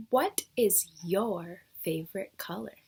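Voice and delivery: normal talking voice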